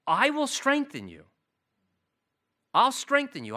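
emphatic promise. Acted. God's promise voiced emphatically.

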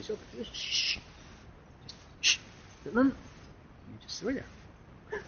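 whispered shh, slow and soft; soft breathy shushing; hushing whisper. Acted. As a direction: hush them gently like a worried child. God hushing the worried listener.

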